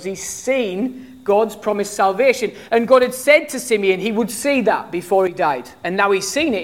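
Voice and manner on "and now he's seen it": normal talking voice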